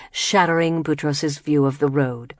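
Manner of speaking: normal talking voice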